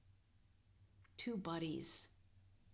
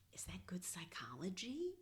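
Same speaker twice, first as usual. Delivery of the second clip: soft, unsure whisper; whispered question; 2 semitones higher, 6 dB quieter than usual. Acted. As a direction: whisper it doubtfully. Charlie Brown's timid question.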